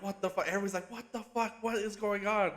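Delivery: high-pitched imitation of a shocked crowd; stunned disbelief. Retelling a stunned crowd's reaction.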